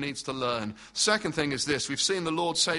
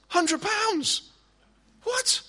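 much higher, a hammed-up squeak of disbelief; mock astonishment; much slower than usual. Preacher to children, hamming up shock at the price. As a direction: squeal the price like you can't believe it. Absurd price in shop story.

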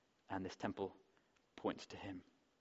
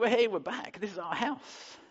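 much higher and louder, bursting with glee; high, loud childish delight; excited kids; 10 semitones higher, 5 dB louder, slower than usual. Retold or imitated. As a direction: cheer like a child arriving home. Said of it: "our kids are running around going".

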